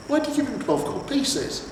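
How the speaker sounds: a horse who can't believe it; lightly voiced horse; incredulous horse.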